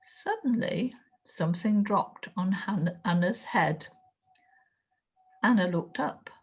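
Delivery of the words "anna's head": ordinary delivery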